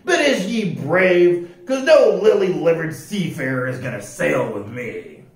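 loud pirate captain, sizing up a recruit. Acted. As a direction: question him like an old sea dog. Voices pirate captain Pete.

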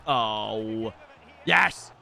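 ordinary delivery

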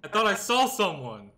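high, loud cry after a scare; rattled outburst. Real, not acted. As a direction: blurt it out, still jumpy. Explaining the scare, still worked up.

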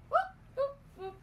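high whooping call; playful whoop. Acted. Calling whoop to wake Grandma.

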